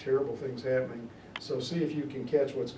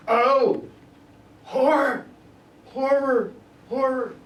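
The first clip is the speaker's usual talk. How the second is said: much higher and louder, each "horror" cried out; horrified cry; 11 semitones higher, 8 dB louder, slower than usual. Acted. Macduff cries out after finding the murdered king, horrified.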